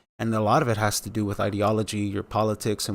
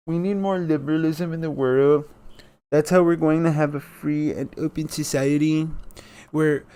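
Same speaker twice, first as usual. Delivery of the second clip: sarcastic mock voice; 5 semitones higher than usual. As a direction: ham up the fake earnest preaching. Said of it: "Sorry, I just can't really help myself".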